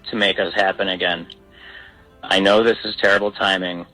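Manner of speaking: normal talking voice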